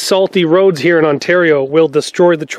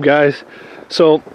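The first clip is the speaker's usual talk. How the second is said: ordinary talk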